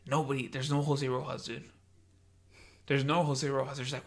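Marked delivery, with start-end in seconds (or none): none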